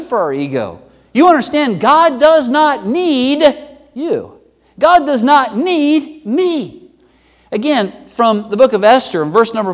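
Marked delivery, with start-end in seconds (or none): 1.1-6.9 high, slow, emphatic declaration from the pulpit; impassioned preaching. Acted. Preacher humbling the church's egos, slow and emphatic. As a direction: preach each word high and drawn out.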